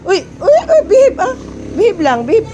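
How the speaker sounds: sharp, high, loud "behave"; scolding the dog. Real, not acted. Owner scolding an excited dog, loud and high. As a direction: rein in a jumping dog with a loud high warning.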